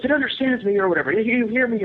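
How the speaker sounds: high, loud excited yelling; thrilled disbelief, called out; Matt excited. Retold or imitated. Matt excitedly yelling that the creature hears him, high. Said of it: "And Matt goes".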